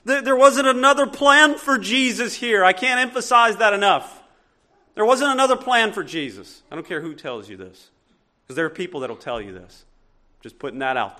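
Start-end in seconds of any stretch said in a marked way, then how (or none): 0.1-6.6 higher and louder, pressing preacher voice; insistent preaching. Acted. Pastor pressing the point on his church, insistent preaching. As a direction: hammer the point home. Preaching Christ's destiny to congregation.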